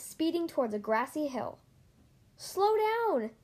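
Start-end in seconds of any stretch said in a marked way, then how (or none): 2.4-3.3 high-pitched urgent yell, at moderate volume. A narrator's reading. Lightning warns his speeding friend Mater, yelling. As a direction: yell a warning in a raised pitch. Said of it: "yelled Lightning".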